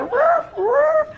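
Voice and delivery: high-pitched "roof, roof" bark; high woofing imitation; imitated dog bark. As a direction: mimic a little dog barking. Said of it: "she's barking".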